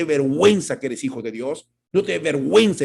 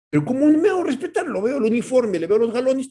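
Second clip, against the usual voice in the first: queja aguda; 10 semitones higher, 5 dB louder than usual. Retold or imitated. Voz del creyente asustado.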